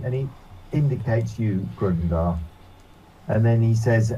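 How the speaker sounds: normal talking voice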